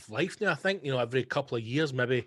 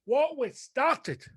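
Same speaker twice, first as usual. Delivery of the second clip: high, slow, dramatic reading of "What We Started"; grand title announcement; 13 semitones higher, 3 dB louder, much slower than usual. Shown as a demonstration. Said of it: "I've written it down so I don't get it wrong".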